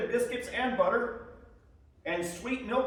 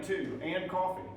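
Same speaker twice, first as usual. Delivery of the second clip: usual speaking voice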